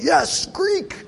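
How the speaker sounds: high, squealing fake enthusiasm; sarcastic put-on cheer; mock excitement. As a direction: pretend the crowd is thrilled about Greek. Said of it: "I can see you're all like".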